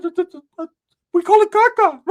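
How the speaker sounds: very high stammering friend voice; stutter, then squeal the last word; stammering squeaky voice. Retold or imitated. Ricky naming his smoke to the chick, very high and stammering. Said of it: "He said".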